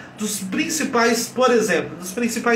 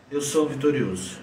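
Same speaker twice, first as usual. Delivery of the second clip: low, subdued, beaten-down delivery; defeated self-affirmation; 5 semitones lower, 4 dB quieter than usual. Shown as a demonstration. Coach showing an affirmation in a defeated posture, low and deflated. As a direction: say it softly from a slumped, defeated posture. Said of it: "você fala assim pra você mesmo".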